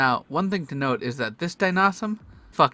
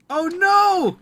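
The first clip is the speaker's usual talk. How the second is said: high, loud dismayed cry; mock alarm; 8 dB louder than usual. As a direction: yelp it. Comic replay of fail.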